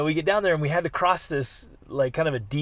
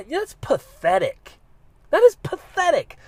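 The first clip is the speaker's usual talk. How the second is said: scornful; 12 semitones higher, 3 dB louder than usual. Retold or imitated. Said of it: "And I remember just saying".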